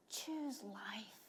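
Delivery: one soft whispered word. Acted. Preacher quoting Jesus to the congregation, whisper. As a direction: breathe the word out.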